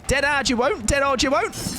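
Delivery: teasing a survivor with a hammed-up shout; mock taunt. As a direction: goad them with a playful yell.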